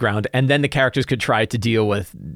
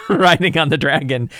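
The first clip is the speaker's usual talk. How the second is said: normal talking voice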